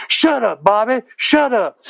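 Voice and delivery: a lightly voiced mother telling her boy off; imitating mama. Mama yelling down at Bobby, mock scolding. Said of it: "my mama stomping the floor up above me saying".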